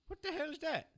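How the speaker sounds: ordinary talk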